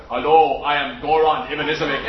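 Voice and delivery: put-on low Croatian-accented voice; Goran Ivanisevic. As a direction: imitate a big Croatian man's accent. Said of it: "I used to particularly love his accent".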